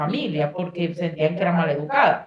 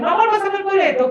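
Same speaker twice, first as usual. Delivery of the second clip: pedido imitado; 11 semitones higher than usual. Retold or imitated.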